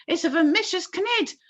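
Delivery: high loud horrified cry. A narrator's reading. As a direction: recoil in horror. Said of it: "cried the chief of the police".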